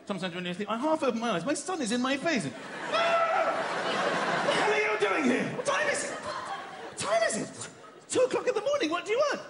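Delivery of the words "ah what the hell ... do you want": high-pitched panicked yelp; startled angry father. Acted. Father woken by son's face at night, alarmed. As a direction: demand answers in shrieking alarm.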